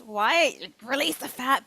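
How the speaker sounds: mock yelling. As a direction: yell slowly at your own body, play-acted. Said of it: "we're yelling at our bodies".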